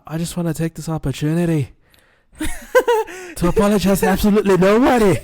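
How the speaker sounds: put-on Conor McGregor Irish accent, high and loud; cocky Irish brogue, laughing through it. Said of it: "you guys are gonna have to excuse my Irish accent".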